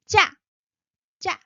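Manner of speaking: tense "ja" hit high; short, tense, high "ja"; high pitched. Shown as a demonstration. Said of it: "high pitched".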